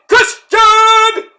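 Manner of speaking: high, loud, stretched-out name; announcer's name holler. Acted. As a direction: belt the name out long for a surprise entrance. Hypes imagined surprise entrance.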